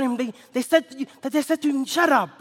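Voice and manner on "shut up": loud, high, contemptuous scolding. Acted. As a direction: crowd telling the beggar off. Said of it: "they scolded him".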